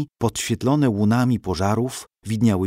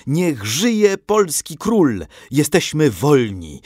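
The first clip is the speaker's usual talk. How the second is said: high, drawn-out cries of triumph; jubilant cheering; 6 semitones higher, 4 dB louder, much slower than usual. A narrator's reading. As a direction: cheer freedom, stretching the last word loud. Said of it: "wołali mieszkańcy Wiednia, ściskając się i całując".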